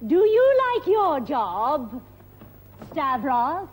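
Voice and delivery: normal talking voice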